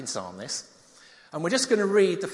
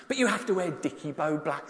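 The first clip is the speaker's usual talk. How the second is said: ordinary delivery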